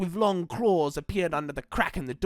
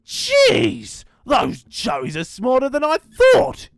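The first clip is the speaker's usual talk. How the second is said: miserable; 8 semitones higher, 7 dB louder, slower than usual. Acted. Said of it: "he said miserably".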